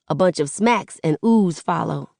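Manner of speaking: plain reading voice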